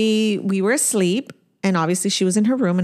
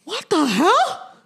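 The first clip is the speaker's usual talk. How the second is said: high, drawn-out acted shock; stunned disbelief; 10 semitones higher, much slower than usual. Mother reacting to her daughter's dream, stunned. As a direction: stretch it out, incredulous.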